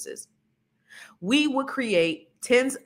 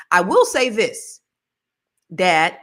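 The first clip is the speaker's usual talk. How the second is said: usual speaking voice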